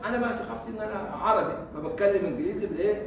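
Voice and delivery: usual speaking voice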